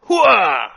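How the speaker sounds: loud, high heroic battle cry; triumphant mock yell. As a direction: bellow it like a giant robot. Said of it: "pop off and going".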